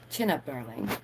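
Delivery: low, soft mock pep talk; soft aside. Cheering herself up, low soft aside. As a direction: murmur it to yourself.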